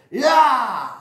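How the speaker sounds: loud, high storybook roar; monster roar. A narrator's reading. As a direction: roar out one long open cry as the monster. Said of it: "and he roars".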